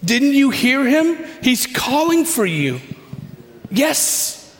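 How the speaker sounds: high, eager encouragement; excited urging. Acted. Crowd telling you he's calling for you, excited urging. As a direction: urge them up with rising excitement. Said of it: "there's now a feeling of excitement".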